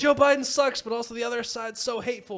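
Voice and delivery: high mimicking voice of political outrage; mock riled-up liberal. Mimicking complaining liberals in argument.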